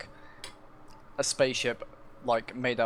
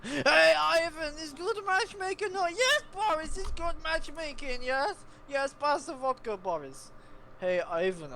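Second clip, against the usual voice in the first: much higher hammed-up Russian accent; 9 semitones higher than usual. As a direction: play two Russian devs in Russian accent. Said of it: "my incredible Russian accent".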